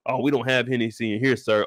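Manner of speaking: deadpan low bartender; mock polite bartender, low. Voicing the bartender.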